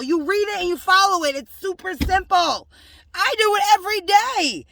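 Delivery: high, rising preacher's cadence; preaching. Acted. Preaching to her believers about the councils, rising and fervent. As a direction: testify it to the congregation.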